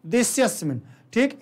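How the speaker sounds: usual speaking voice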